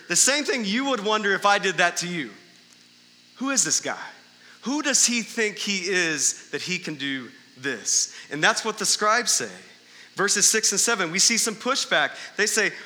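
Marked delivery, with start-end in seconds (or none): none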